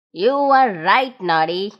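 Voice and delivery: put-on low, older gnome voice; a low, fond old friend; Big Ears thanking Noddy. A narrator's reading.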